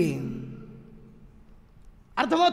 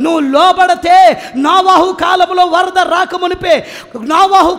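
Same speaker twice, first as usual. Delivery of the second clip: louder and louder, building to a shout; fiery preaching; 8 dB louder than usual. Acted. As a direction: preach it to the rafters. Climactic preaching.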